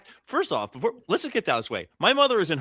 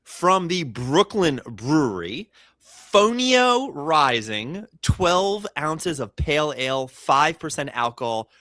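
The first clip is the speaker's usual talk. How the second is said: slow label read; slower than usual. Acted. Reading beer label slowly.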